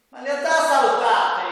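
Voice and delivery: high, loud puppet voice; cheeky puppet talking back; italian puppet voice. Acted.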